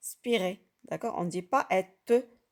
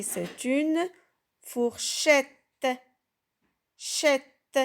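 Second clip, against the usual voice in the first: "fourchette" said a bit higher and louder, then "Chette" alone; model word; 5 semitones higher, 3 dB louder, much slower than usual. Shown as a demonstration. Teacher to pupils, naming the picture: a lifted "fourchette". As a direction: lift "fourchette" and repeat its last syllable. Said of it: "Regardez l'image".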